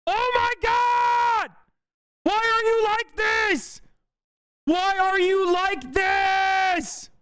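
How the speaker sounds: slow, very high, stretched mock shout; mocking shriek. Streamer mocking a chatter's outrage with a slow, high shriek. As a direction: wail it like an overdramatic whiner.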